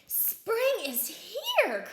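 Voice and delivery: slow, high, breathy cry of joy; excited whisper. A narrator's reading. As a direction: whisper the news with delight. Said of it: "cried Mole".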